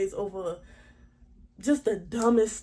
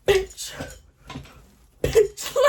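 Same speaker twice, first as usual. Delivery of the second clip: gleeful exclamation; 11 semitones higher, 6 dB louder than usual. Retold or imitated. Imagining swinging the chair.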